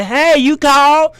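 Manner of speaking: high, slow, sing-song hey; lightly voiced flirty neighbour; lilting call from next cell; neighbour calling over.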